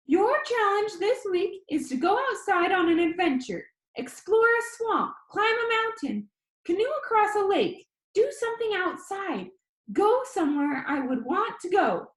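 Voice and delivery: high, eager, encouraging call; rally the viewers like an excited kid; high squeaky boy. Acted. Danny challenges his viewers in a high squeaky voice. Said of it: "His voice was high and squeaky".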